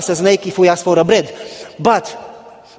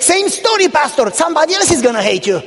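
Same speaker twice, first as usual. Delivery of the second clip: church lady voice; 8 semitones higher than usual. Retold or imitated. Church lady teasing the pastor.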